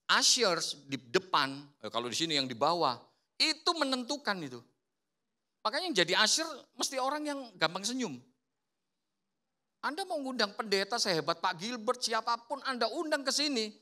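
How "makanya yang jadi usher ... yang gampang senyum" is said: ordinary talk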